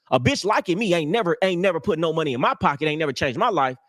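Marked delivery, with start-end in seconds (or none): none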